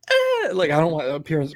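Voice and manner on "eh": high squawked eh; a high silly eh; mock yell. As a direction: honk out a silly noise at a driver. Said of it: "just go".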